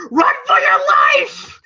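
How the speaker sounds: high, drawn-out yelled warning; Mickey's panicked shout. Retold or imitated. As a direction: yell a mock desperate warning. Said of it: "you hear Mickey going".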